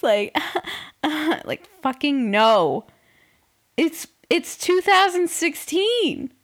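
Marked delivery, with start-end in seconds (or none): 1.8-6.3 exasperated. Real, not acted. Exasperated rant.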